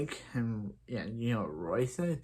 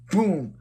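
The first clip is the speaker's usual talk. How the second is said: a high, loud boom; loud mock boom; 6 semitones higher, 6 dB louder than usual. Oliver knocks her out, loud boom. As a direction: smack it out like a punch.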